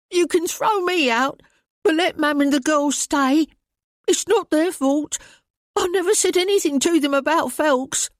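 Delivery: put-on high pleading boy's voice, frightened; terrified pleading. A narrator's reading. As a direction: beg like a scared boy for his family.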